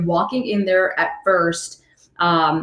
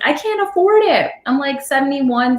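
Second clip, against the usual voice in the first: high-pitched imitated plea; retold protest; 7 semitones higher than usual. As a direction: voice an older tenant pleading hardship. Voices older tenant protesting rent hike.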